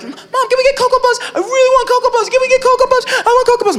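put-on high pleading child; whiny kid voice. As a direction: beg like a whiny little kid. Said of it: "my brother would be like".

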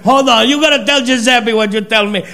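ordinary talk

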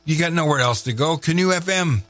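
fast, high DJ patter; radio announcer. Acted. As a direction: hype up the show. Radio host show promo.

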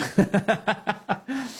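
high burst of chuckling; laughing. Real, not acted. Host amused by his moon joke, laughing.

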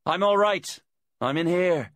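high, loud call through a wall; calling from inside. A narrator's reading. Captive woman calls from inside the hut, high and loud. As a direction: call out high so they hear you outside. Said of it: "Gemma's voice came muffled from inside".

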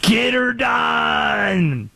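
high, long-drawn Southern holler; mock Southern accent. Host imitating foreigners' idea of an American, Southern accent. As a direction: stretch it out in a hammed-up Southern accent. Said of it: "they're always doing Southern".

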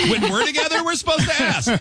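high, laughing protest; laughing disbelief. Real, not acted. Laughing disbelief, much higher.

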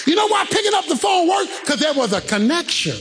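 high, shouted sermon delivery; full-force preaching. Real, not acted. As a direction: drive the point home to the congregation.

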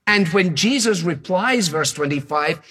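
ordinary delivery

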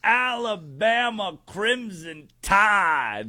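high, drawn-out hype voice; excitable sports-show impression; Pat McAfee. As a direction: stretch the team name out big and high. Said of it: "do my best Pat McAfee impression".

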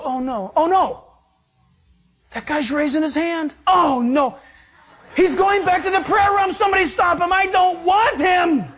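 high, hammed-up alarm building to a wail; mock panic. Preacher mocking a God who rejects a convert, mock panic. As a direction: play horrified dismay, pitch climbing, last words stretched out.

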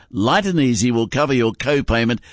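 ordinary delivery

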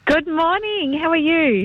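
bright, smiling greeting. Real, not acted. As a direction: greet him with a smile you can hear. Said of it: "I can hear the smile in your voice".

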